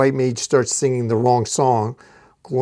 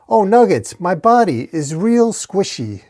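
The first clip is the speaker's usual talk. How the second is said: higher, comic whine; cartoonish lament, pitched up; ghost chicken's dismay; 6 semitones higher than usual. A narrator's reading. Ghost chicken lamenting its crushed body, high comic dismay.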